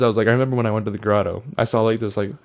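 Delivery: ordinary delivery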